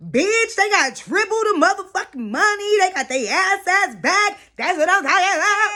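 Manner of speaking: high, loud squealing celebration; gleeful hype. Real, not acted. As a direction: cheer like you just hit the jackpot. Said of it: "cheering the couples' money".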